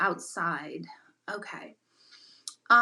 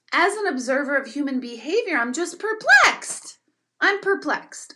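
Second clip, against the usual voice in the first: exasperated emphasis; 5 semitones higher, 6 dB louder than usual. Real, not acted. Observer of human behavior venting to viewers, loudly exasperated.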